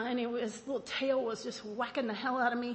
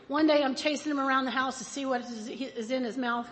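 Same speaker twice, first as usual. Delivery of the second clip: ordinary delivery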